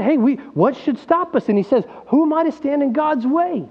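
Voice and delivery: high, eager, lifted voice; excited Peter. Retold or imitated. Peter excitedly asking who can stand in God's way. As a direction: burst out with glad excitement. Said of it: "He's excited".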